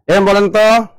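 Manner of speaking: usual speaking voice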